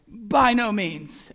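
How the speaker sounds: slow, weighty and firm; emphatic denial, drawn out. Retold or imitated. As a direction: lean hard on every word, slowly. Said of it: "And this is emphatic".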